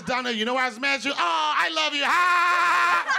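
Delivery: fast, loud, high mock gushing; mock confession. Mocking friend's staged apology, loud hammed-up gushing. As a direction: ham up a fake tearful confession. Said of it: "It's gonna be like this".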